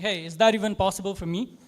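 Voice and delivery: ordinary talk, nothing marked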